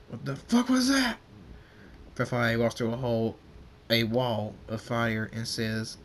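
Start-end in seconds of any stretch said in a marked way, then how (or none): 0.1-1.2 very high, loud alarmed shout; a general yelling in disbelief; shocked scream. Acted. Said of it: "A general screams".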